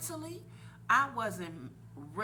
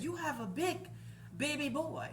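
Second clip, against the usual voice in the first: lightly voiced doctor, higher and sing-song; imitating the doctor; 6 semitones higher than usual. Showing how the doctor announced the baby boy, lightly mimicked. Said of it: "That's how he said".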